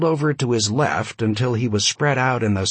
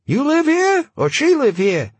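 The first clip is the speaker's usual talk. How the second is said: put-on high, loud voice, light Yiddish accent; slight Yiddish accent; 5 dB louder than usual. A narrator's reading. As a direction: press him in a high Yiddish-tinged voice. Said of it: "He had a slightly Yiddish accent".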